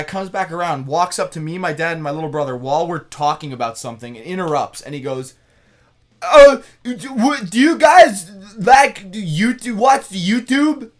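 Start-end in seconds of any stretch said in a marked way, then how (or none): 6.2-10.9 high, loud put-on voice of an awkward stranger; mock the odd guy, pitched way up and loud; imitating the guy. Imitating the stranger in line at Costco, high and loud. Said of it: "he sounded exactly like that".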